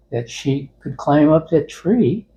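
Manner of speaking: normal talking voice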